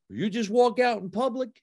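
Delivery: high-pitched, incredulous imitation; mock a stranger's astonished question; big guy. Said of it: "some big guy".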